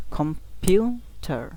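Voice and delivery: Computer slowly, পিউ long and strong; three-beat computer, middle emphasized; stress on middle syllable. Shown as a demonstration. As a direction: split computer and punch পিউ. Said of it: "এটা একটু জোরে বলতে হবে".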